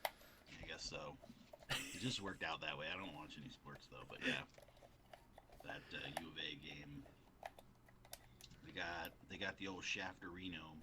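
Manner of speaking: far from the mic, very quiet; faint, distant speech off mic; quiet voice loud hiss. Real, not acted. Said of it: "You're super quiet because no one".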